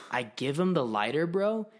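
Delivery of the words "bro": normal talking voice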